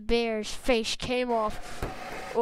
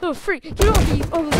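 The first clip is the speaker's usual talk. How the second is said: higher, louder toy fighter shout; pretend angry yell; 7 semitones higher, 9 dB louder, faster than usual. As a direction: shove them off with a yell. Toy fighter shoves attacker.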